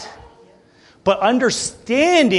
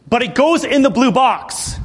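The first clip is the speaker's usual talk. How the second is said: put-on stubborn little boy insisting, a bit higher; insistent kid; 7 semitones higher, 3 dB louder than usual. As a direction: imitate a kid digging in his heels. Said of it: "And he said it like that".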